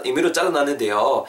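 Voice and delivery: ordinary delivery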